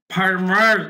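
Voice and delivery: ফার্মার said slowly with a clearly sounded American r. Shown as a demonstration. Teacher showing students a clear r in ফার্মার. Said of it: "একদমই স্পষ্ট করে বলতে হবে".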